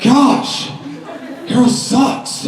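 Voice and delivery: high, exasperated nerd impression; petulant high-pitched character voice; Napoleon Dynamite. Shown as a demonstration. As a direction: huff it out as a put-upon movie geek. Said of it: "Napoleon Dynamite".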